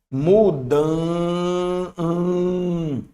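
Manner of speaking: "Mudan-" sustained on its nasal sound; drawn-out nasal vowel. Shown as a demonstration. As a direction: hold the "an" of "Mudan-" as a long hum. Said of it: "Eu tô vendo um".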